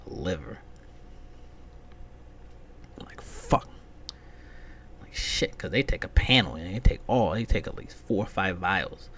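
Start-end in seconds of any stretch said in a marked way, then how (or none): none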